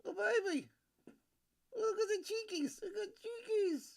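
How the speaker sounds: put-on high cooing baby-talk voice; cooing to a baby on screen. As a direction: coo at the baby's cheeks up high. Talking to the baby.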